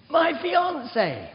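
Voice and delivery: high, loud, slow, drawn-out yell; shouting like mad. Retold or imitated. Telling the deaf old man across the ward, loud slow shout. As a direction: announce it at the top of your voice. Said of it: "shouting like mad".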